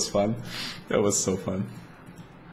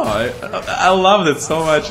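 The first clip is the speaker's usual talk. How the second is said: louder, laughing through the words; laughing fondly; 7 semitones higher, 4 dB louder than usual. Real, not acted. Telling viewers about the girl, laughing fondly. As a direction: gush about it while laughing.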